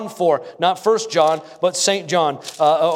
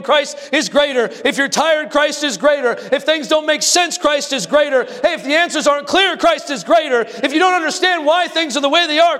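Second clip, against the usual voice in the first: higher, building chant of "Christ is greater" after each trouble; preaching at full force; 7 semitones higher than usual. Acted. Pastor rousing the congregation through their troubles, preaching at full force. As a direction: preach it rising, hammering every answer home.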